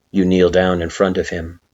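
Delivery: usual speaking voice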